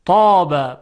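طاب with a thick, emphatic ط and dark long vowel; careful model of طاب; heavy emphatic ta. Shown as a demonstration. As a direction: make the ط in طاب full and heavy. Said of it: "با حرف تا".

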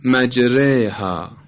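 مجریها with the alef after ر bent to a long 'ey'; imala on مجریها. Shown as a demonstration. Tajvid teacher showing Hafs's reading, مجریها with imala. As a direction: draw out مجریها slowly as majreyha, light r.

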